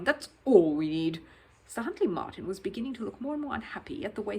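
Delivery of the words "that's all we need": booming. Acted. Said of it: "he boomed into the microphone".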